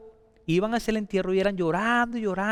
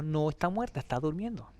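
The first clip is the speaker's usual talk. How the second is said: hushed; 4 semitones lower, 9 dB quieter than usual. Acted. Jesus voiced very softly.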